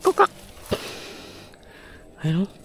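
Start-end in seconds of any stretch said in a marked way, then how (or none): 0.0-0.3 frog croak. Retold or imitated.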